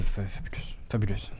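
« fabuleuse » soufflé tout bas; un murmure admiratif; chuchoté. Acted. Vante la couverture du magazine. (chuchoter « fabuleuse » avec extase)

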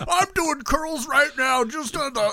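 high, stretched-out gushing mimicry; mock your friend's hype in a silly high voice; mocking impression of co-host. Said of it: "and you're like".

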